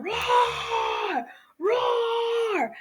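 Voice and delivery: very high, drawn-out mock roar; lion roar. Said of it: "He's roaring".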